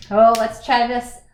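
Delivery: ordinary talk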